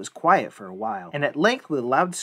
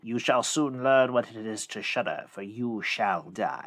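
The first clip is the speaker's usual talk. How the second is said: low, very slow threat; menacing old fiend; 3 semitones lower, 3 dB quieter than usual. Acted. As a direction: draw out the death threat, sinking lower. Terrible old man threatens the youth.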